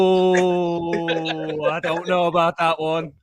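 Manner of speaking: long drawn-out doubtful "oh"; Gary Neville. Retold or imitated. Fan mimics commentator judging a handball, mock-dubious. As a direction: imitate a commentator sucking in doubt, stretched out. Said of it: "and he's just going".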